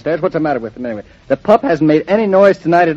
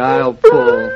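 high strained push of breath; straining effort; 3 dB louder than usual. Real, not acted. Husband tugging the stubborn dog down the steps, straining. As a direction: grunt it out while pulling hard.

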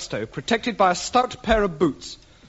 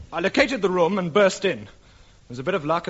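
ordinary talk

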